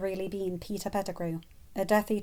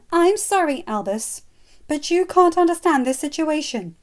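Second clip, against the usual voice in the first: high, loud, pleading; plead, at a loss; helpless; 8 semitones higher, 10 dB louder than usual. Acted. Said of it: "Molly said helplessly".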